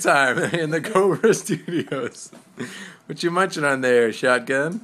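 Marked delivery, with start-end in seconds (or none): 0.0-2.3 loud, slow, laughing through words. Real, not acted. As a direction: giggle out the correction. Laughs through a flubbed intro.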